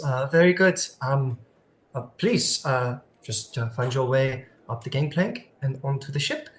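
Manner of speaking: a lightly put-on French accent, polite host. Greeter welcoming Cross aboard, lightly French-accented. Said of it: "I keep turning into a French person".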